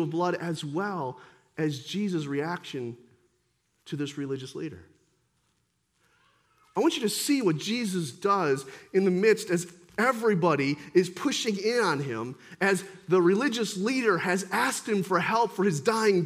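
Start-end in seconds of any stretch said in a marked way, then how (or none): none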